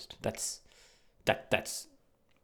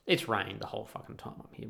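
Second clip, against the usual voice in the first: ordinary talk, nothing marked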